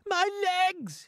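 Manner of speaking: very high outraged scream; angry scream. A narrator's reading. Paint-covered centipede cries about his legs, screaming with anger. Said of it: "The centipede screamed with anger".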